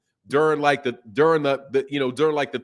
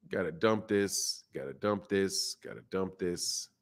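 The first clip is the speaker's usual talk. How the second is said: trailing off, each repeat softer and lower; fading mutter; 7 semitones lower, 10 dB quieter, slower than usual. Real, not acted. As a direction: let it die away as you walk off. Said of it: "I gotta dump this".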